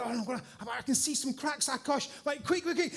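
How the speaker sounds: hustle your helper along; retold hurry. Spotting cracks, hurrying Akash.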